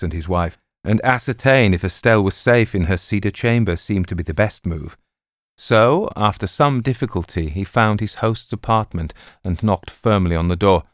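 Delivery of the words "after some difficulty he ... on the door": usual speaking voice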